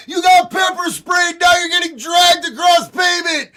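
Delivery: shouted mocking jeer, high and loud; mock him at the top of your voice; loud taunting. Real, not acted. Taunting the man in video.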